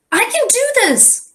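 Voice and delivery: put-on high, eager kid voice; eager little boy. A narrator's reading. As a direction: sound small but determined. Little leprechaun declares eagerly.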